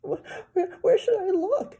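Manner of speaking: put-on high, loud panicky girl; flustered girl. A narrator's reading. Girl panicking about finding the teeth. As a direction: ask in a fluster. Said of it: "Sheila asked".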